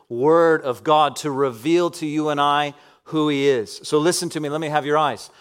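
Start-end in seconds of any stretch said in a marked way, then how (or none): none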